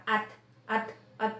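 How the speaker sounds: "at" cut shut on the T; closed final T. Shown as a demonstration. Teacher showing learners a closed final sound, T shut off in "at". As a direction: stop the T in "at" without releasing it. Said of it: "sarado, at".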